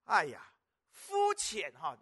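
ordinary talk, nothing marked

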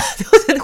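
quick loud chuckle; short real laugh. Real, not acted. Narrator laughing at the cat owner who never listens. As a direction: let a laugh slip out.

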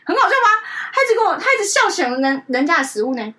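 high, loud laughing disbelief; loud laughing at the funny dog. Real, not acted. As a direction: laugh through it, amused. Laughing at dog's obsession.

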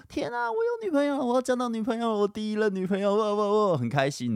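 put-on bursting with joy like a boy who just got a girlfriend; giddy joy. Teenage self exulting in the shower over his first girlfriend, ecstatic high voice.

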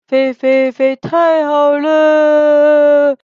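loud, long-drawn cheer. A narrator's reading. Little people cheer leaving the desert, loud drawn-out joy. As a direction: stretch it out in triumph.

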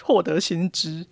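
ordinary talk

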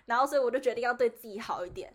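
ordinary delivery

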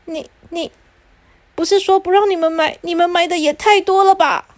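too dizzy to finish, then complain; stunned father. Acted. Father stunned by all the machines, scolding.

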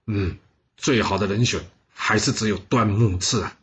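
ordinary delivery, nothing marked

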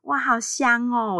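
put-on slow, sweet baby-talk voice; 娃娃音. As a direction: coo slowly like to a baby. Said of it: "你现在连讲话都是娃娃音". (慢慢的娃娃音)

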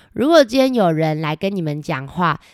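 plain reading voice

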